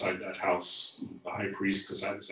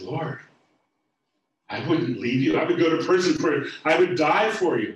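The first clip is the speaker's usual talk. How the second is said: high, loud vow of loyalty, pushing faster; loud protest; 7 semitones higher, 12 dB louder than usual. Acted. Peter vowing to Jesus he'd die for him, loud and pleading. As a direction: insist fiercely that you'd never leave.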